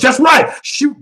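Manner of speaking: sudden high, loud shout of approval; excited exclamation. Real, not acted. Host hypes women who shoot guns, excited shout. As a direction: burst out with hyped agreement.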